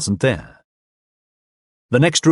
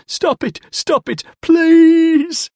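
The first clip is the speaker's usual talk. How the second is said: much higher, rising to a loud shriek; desperate pleading screams; 5 dB louder, much slower than usual. Acted. Drunk begging as he is beaten, pleading screams. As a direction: beg for your life.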